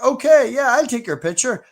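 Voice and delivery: lightly acted cheerful agreement; mock eager self. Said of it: "And I was like".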